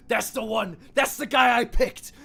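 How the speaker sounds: high, loud excited yell; boastful shouting. Acted. Armored god claiming his chosen one, boastful shout. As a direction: crow it over the crowd. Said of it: "the man with the armor that's too big going".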